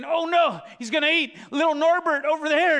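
put-on high, loud panicked boy; a shepherd boy spotting a lion; alarmed kid. Boy spotting a lion near his sheep, panicked and loud. David voiced seeing lion.

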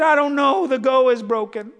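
a hint of a puzzled small boy; little boy. Preacher as boy explaining broken toy to dad, lightly voiced little boy. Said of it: "A little boy had a toy car".

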